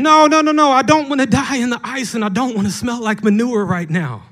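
high, loud panicked bird scream. Acted. Preacher voicing a bird under a cow, screaming high and loud. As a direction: shriek in hammed-up panic. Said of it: "The bird is screaming".

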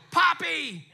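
put-on high child voice calling Poppy with a laugh; child's playful call. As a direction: imitate a grandchild calling grandpa. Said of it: "then they go".

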